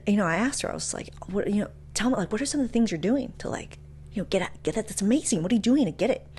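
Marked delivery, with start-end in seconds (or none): none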